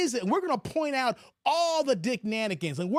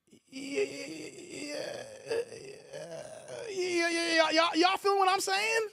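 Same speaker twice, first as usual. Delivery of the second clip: laughing stammer; 4 semitones higher, slower than usual. Real, not acted. Stammering build to laughing shout.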